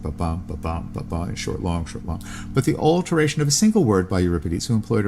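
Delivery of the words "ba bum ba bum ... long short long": soft, rhythmic ba-bum with short and long beats; iambic rhythm demo. Shown as a demonstration. Reader demonstrates iambic meter to listeners, soft and rhythmic. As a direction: tap out the iambic beat softly. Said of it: "Of course, we all know iambic".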